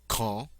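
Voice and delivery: quand said kahn, a hard K and a nasal vowel, no final D; French quand, hard K, nasal ending. Shown as a demonstration. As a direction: say quand like kahn, through the nose. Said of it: "notice not quando, but quand".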